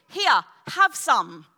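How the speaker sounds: slow, loud, playful imitation; imitating a friend's teasing invitation; jokingly serious offer. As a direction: offer it slowly and firmly, half joking. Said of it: "jokingly but seriously, she goes like this".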